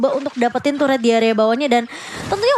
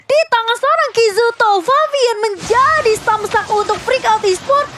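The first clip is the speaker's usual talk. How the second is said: fighting game announcer; 6 semitones higher than usual. Real, not acted. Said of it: "hint: fighting game announcer, triple kill call".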